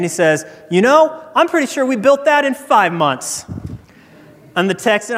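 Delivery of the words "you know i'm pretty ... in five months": higher-pitched, confident comeback; guide one-upping. Acted. Tour guide countering the boastful Texan, high one-upping. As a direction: answer as a guide refusing to be bested. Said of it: "I'm not gonna let him get the best of me".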